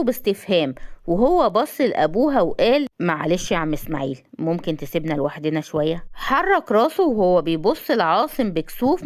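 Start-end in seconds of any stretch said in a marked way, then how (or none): 3.0-6.0 low, even man's voice; composed young man. A narrator's reading. Asem asks her father for privacy, low and calm. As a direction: voice him low and politely restrained.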